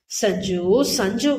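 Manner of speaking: slow, drawn-out weary name-calling; weary exasperation. A narrator's reading. Husband giving in to his upset wife, wearily exasperated. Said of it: "என சலித்தவன்".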